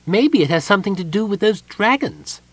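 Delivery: high, light kender voice, wondering; a curious little kender pointing out dragons; lightly voiced curious kender; kender Tasselhoff. A narrator's reading.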